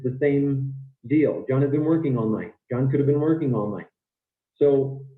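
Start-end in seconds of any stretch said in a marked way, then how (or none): 1.5-3.9 quick natural connected speech; pretty fast. Shown as a demonstration. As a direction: rattle it off, squeezing the small function words. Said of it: "I'm talking pretty fast here".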